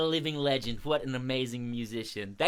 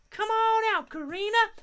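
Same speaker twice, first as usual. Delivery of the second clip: very high, light call; high echoed call; 3 dB louder than usual. Pretend. Audience call to bring out the crow, high-pitched calling.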